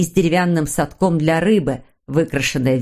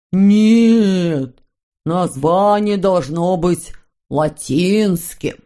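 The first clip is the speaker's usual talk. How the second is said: pompous shouted objection; much slower than usual. Acted. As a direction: object slowly, stretching the vowels. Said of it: "закричал Хемуль". (протяжный крик возражения)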